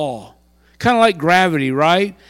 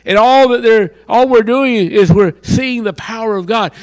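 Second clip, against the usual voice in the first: ordinary talk, nothing marked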